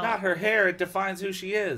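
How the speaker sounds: high, loud melodrama; playful exaggerated dismay; mock outrage. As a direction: protest as if it's a tragedy. Listener mock-mourns the hair.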